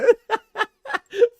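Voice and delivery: high, loud belly laugh. Real, not acted. Laughing at a chat member's joke, high and loud.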